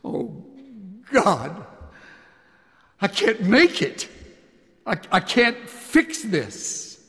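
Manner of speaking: a panicked prayer shouted from cold water; desperate cry to God. Retold or imitated. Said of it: "a crying out of my inner soul".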